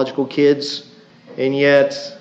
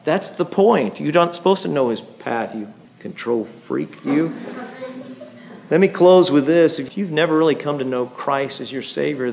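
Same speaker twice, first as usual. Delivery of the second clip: ordinary talk, nothing marked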